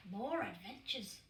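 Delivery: ordinary delivery, nothing marked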